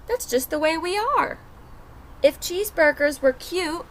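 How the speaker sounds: put-on lively first-person wolf narrator for kids; storybook read-aloud. A narrator's reading. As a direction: tell it as the wolf, reading to a class. Reads the wolf's story aloud.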